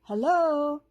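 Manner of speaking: hedgehog calling. A narrator's reading. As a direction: call up into the tree. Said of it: "he hollered out again".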